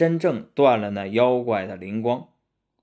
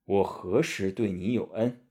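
low, soft monk's voice; Tang Seng questioning; 5 semitones lower, 5 dB quieter than usual. Acted. Monk asks his disciple about the debt, low grave voice. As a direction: question him in a lowered, quiet voice. Master answers in character.

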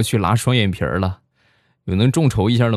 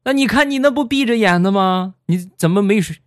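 put-on high-pitched, contradicting old passenger; insistent elder; 12 semitones higher than usual. Old passenger disputing he was awake, high and insistent. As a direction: argue back as a nosy old passenger. Bus elders argue back, acted.

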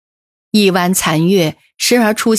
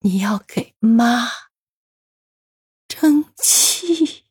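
dying words; 4 semitones higher, 4 dB quieter, much slower than usual. A narrator's reading. Mother's final plea before death.

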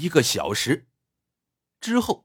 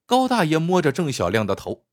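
usual speaking voice

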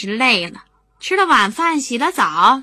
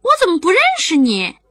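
put-on higher, louder startled kid asking quickly; a child confronting a stranger; surprised little boy; puzzled child; 8 semitones higher, 6 dB louder than usual. A narrator's reading. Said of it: "明明说".

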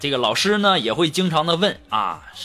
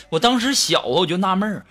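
ordinary delivery

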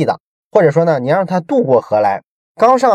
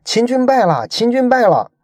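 imitated shouting; 10 semitones higher than usual. Planted men calling out to retreating troops, imitated shouting. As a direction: call it out twice, pitched well up. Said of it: "在这个部队啊后撤的过程中大喊".

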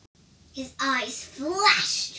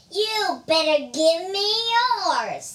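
threatening demand; 7 semitones higher, 10 dB louder than usual. Acted. Robber threatening the monkey.

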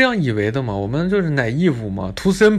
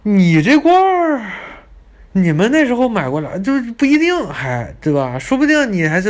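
indignant outburst; 7 semitones higher, 4 dB louder than usual. Real, not acted. Answering the guide's insult about the jar, indignant loud retort. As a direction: fire back in outrage.

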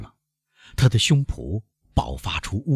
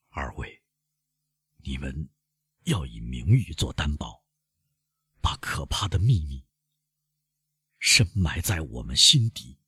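hushed, stifled plea, very soft at first; choked voice; 3 semitones lower, 4 dB quieter than usual. A narrator's reading. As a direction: hold the voice back, nearly whispering, pausing between phrases. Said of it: "他用憋住的声音". (憋着声音，压低了说)

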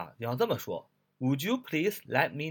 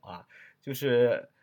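ordinary talk, nothing marked

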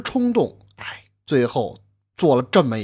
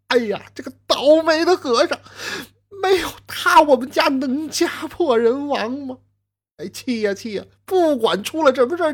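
much higher wailing curses through grief; crying and cursing; 11 semitones higher, 3 dB louder than usual. Acted. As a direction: rail at the monk while sobbing. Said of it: "还一边的骂骂咧咧的". (丈夫边哭边骂和尚，高声哭骂)

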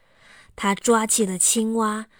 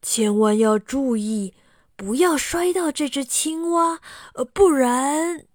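a lightly voiced merchant, cautioning, trailing off; hint of a secretive seller, hold back the rest; merchant's warning; 4 semitones higher, 4 dB louder than usual. Merchant cautions Xiaowei about the frog, a hinted secretive warning.

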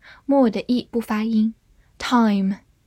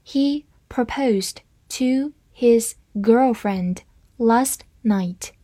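slow reading; much slower than usual. Shown as a demonstration. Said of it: "好，慢慢来读。".

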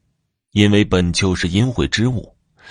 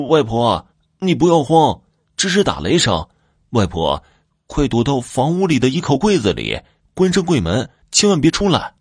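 put-on high, urgent child's call; raised voice; 7 semitones higher than usual. A narrator's reading. Youngest son warning grandmother about thunder, raised voice. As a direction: pitch it well up, calling out a warning. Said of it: "大声地说道".

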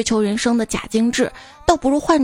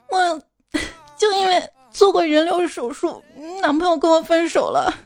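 mild imitated crying; 5 semitones higher than usual. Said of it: "闺蜜就在我这儿哭得稀里哗啦的".

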